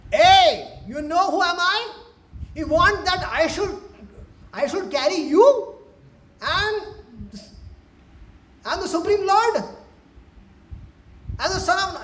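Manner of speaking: high, loud, puffed-up protest; loud, high-pitched bragging of an offended boy; indignant boast. Acted. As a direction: shout it like an outraged little lord. Krishna boasting in acted quarrel.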